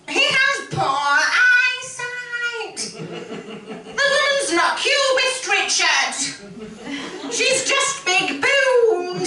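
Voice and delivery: high, slow, shrill-posh snooty lady; hammed-up fussy snob at a gallery; Hyacinth Bouquet. Impersonating Hyacinth scolding husband Richard. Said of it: "Patricia Routledge slash Hyacinth Bouquet".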